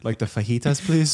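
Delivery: hammed-up Spanish accent on "fajitas", voice lifted on please; mock Spanish order. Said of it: "when you order, um, in Spanish".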